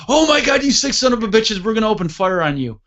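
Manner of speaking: high-pitched yelled threat, retelling; outraged radio outburst. Retold or imitated. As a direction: imitate a buddy losing it over the radio. Outraged radio call, acted in story.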